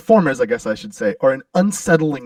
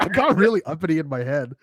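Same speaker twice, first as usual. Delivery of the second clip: normal talking voice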